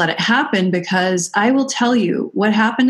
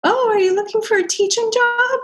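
much higher gushing greeting; excited studio owners. Retold or imitated. Studio owners greeting her, a high gushing mock voice. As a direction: gush it out like delighted owners. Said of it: "And then they were like".

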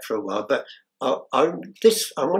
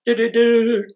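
high, loud mock trumpet fanfare; 11 semitones higher, 7 dB louder, slower than usual. Escape artist bursting out of the sack, high loud mock fanfare. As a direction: trumpet the big reveal.

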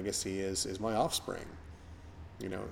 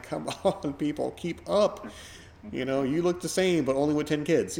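louder, higher half-laughing "come on, keep up"; exasperated urging; 6 semitones higher, 4 dB louder than usual. Retold or imitated. Urging old acquaintances to keep up, loud and laughing with exasperation. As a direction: egg them on with amused impatience. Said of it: "I'm like".

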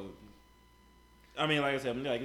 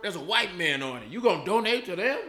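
higher and louder outrage; outraged; 6 semitones higher, 9 dB louder than usual. Real, not acted. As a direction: push the disbelief up and loud. Outraged about board.

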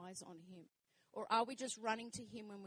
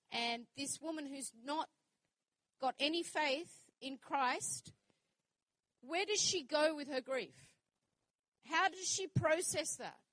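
impassioned plea; 5 semitones higher, 8 dB louder than usual. Real, not acted. Passionate rhetorical questions.

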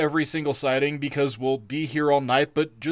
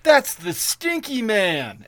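normal talking voice

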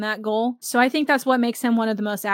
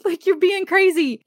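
exasperated Jack; 8 semitones higher, 3 dB louder than usual. Retold or imitated. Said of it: "and is like".

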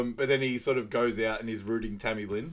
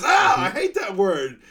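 mock offended; 12 semitones higher, 11 dB louder than usual. Man reacting to Laurie's insult, hammed-up hurt. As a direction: overplay being wounded.

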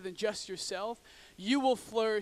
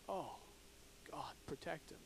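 hushed, much softer, lower plea; whispered prayer; 8 semitones lower, 18 dB quieter than usual. Retold or imitated. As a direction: breathe the prayer in shock at the crash. Driver sees crash, prays.

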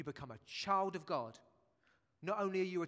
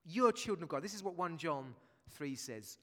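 ordinary talk

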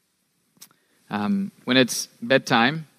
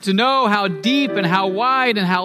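building preaching; 9 semitones higher than usual. Acted. Pastor swelling to a climax about Christ's love, building preaching. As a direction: climb in pitch and force with each phrase.

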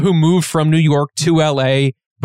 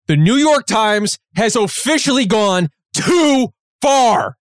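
slow, high mock-grave announcement; mock outrage; 7 semitones higher, slower than usual. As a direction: pronounce it like a solemn verdict. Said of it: "but I'm saying it here".

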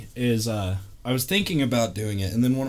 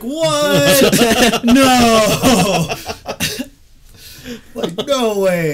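much higher and louder, laughing disbelief; stunned disbelief; 13 semitones higher, 12 dB louder, much slower than usual. Retold or imitated. Recruits hearing the sergeant is from Compton, shocked laughing outburst. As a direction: cry out like you can't believe it.

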